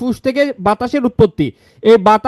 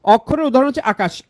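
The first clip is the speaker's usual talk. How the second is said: usual speaking voice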